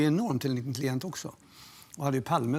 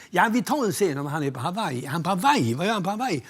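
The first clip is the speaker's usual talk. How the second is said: mock incredulous; 4 semitones higher, 6 dB louder than usual. Producer argument acted out.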